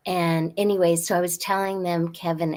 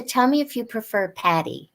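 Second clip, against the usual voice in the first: normal talking voice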